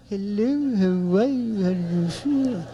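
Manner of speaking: wispy, drawn-out sweet little voice; soft-voiced girl. Girl on the phone to boyfriend, soft-voiced. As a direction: imitate a girl barely audible on the phone. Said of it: "a girl with a soft voice".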